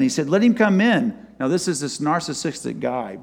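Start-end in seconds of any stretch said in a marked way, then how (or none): 0.3-1.1 commanding call, higher and louder; royal command. Retold or imitated. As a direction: give the order like a king. King orders Haman brought in.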